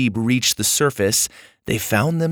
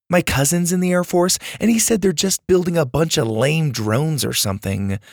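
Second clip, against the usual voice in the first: put-on higher-pitched, dismissive know-it-all girl; unimpressed girl; 5 semitones higher than usual. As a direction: debunk it in a high, dull-eyed girl voice. Lainey corrects Max.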